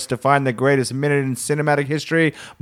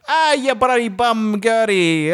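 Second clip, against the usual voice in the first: slow, higher hammed-up Italian accent; second go at Italian; 10 semitones higher, slower than usual. Retrying the Italian voice for co-host, slower and higher. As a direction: stretch out the mock Italian accent. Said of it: "let me try that again".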